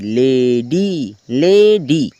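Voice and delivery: Lady drawn out as "lay" and "dee"; two separate syllables. Shown as a demonstration. Teacher showing double syllables, lady said in two long beats. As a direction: stretch both beats of lady, lay, dee, each time. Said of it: "double syllable".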